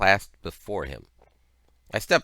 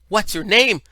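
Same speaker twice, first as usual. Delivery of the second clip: put-on high, loud child voices; loud, high-pitched clamour of curious kids; children pelting questions; 11 dB louder than usual. A narrator's reading. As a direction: fire questions like excited village children. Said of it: "children surrounded me and pelted me with questions".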